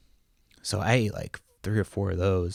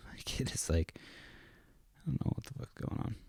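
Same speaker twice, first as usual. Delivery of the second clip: whisper; 3 semitones higher, 13 dB quieter than usual. Real, not acted. Dad checking the baby monitor, whispering to listeners. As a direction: keep it hushed under the breath.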